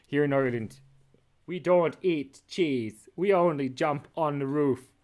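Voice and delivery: loud, slowed mock Irish; big playful Irish accent. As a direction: declare it in a hammed-up Irish accent. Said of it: "he's so Irish".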